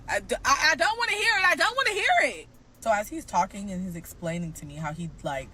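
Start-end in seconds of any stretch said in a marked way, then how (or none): 0.7-2.5 high, loud refusal; high loud refusal said twice; mock yell. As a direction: shout it down, higher each time. Retelling her reaction.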